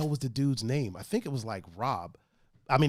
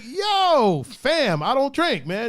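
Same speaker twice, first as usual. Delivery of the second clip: mock threat; 6 semitones higher, 4 dB louder than usual. Retold playful threat, raised voice.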